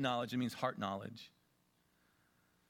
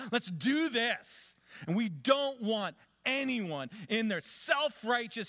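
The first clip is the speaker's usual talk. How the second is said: mock sinful glee; 11 semitones higher, 5 dB louder, slower than usual. Voicing the sinful crowd's pull.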